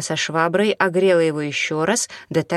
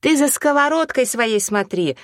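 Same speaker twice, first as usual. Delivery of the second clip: sharp, higher-pitched angry snap; barked; 8 semitones higher, 3 dB louder than usual. A narrator's reading. Mop woman scolds the pancake cook, barking. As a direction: snap at her to mind her business. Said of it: "рявкнула на неё женщина".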